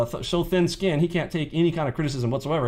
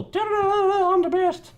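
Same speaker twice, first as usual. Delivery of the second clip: much higher, sing-song mock boast; mocking gloat. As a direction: mimic a troll crowing about being the best. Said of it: "That's a joke".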